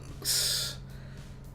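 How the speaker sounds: long winded exhale; out of breath mid-story; panting breath. Real, not acted. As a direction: let the air out hard, tired. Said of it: "I'm out of breath".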